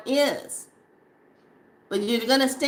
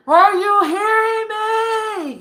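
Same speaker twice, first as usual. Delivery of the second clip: much higher, louder and slow yell; ancestors yelling; 13 semitones higher, 8 dB louder, slower than usual. Retold or imitated. Ancestors yelling at the listener who ignores them, a drawn-out shout. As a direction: stretch it out like a spirit shouting into an ear. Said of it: "they're yelling".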